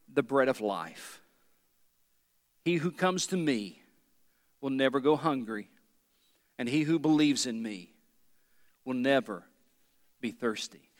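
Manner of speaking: solemn scripture reading. Acted. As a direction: read it like a holy declaration. Reading Jesus' words slowly.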